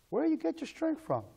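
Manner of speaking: high-pitched curious asking; acted questioner. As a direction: ask it high, as someone else. Voices someone questioning the believer.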